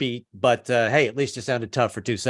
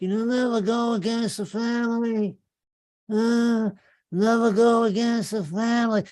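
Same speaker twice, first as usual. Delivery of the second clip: higher-pitched mumbling Brando impression; mumbling Godfather; 9 semitones higher than usual. Comedian mimics Brando's Godfather, high and mumbled. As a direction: mumble it like a mob boss. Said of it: "he mumbled through the whole movie".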